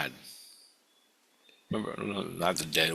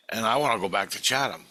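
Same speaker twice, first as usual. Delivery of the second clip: normal talking voice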